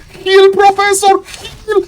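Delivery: very high pleading, ending in a wail; desperate cry. Real, not acted. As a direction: beg the player as it slips away. Desperate cries as hero dies.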